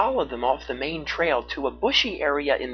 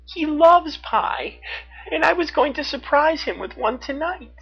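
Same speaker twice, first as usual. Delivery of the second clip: put-on tearful child; 3 dB louder than usual. A narrator's reading. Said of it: "she sobbed".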